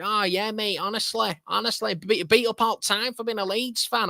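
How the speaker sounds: high, exaggerated Mancunian; Mancunian lad. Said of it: "that is what you call a Mancunian accent".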